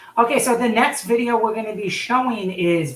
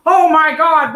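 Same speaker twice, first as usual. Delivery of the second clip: a higher, louder burst of real surprise; surprised exclamation; 7 semitones higher, 8 dB louder, slower than usual. Real, not acted. Host reacting to what the returning guest shows, surprised. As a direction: blurt it out, caught off guard.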